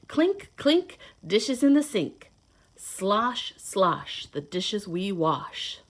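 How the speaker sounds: lively storybook read-aloud; reading to children. Acted. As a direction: read it like a picture book to little kids. Reads picture book to children.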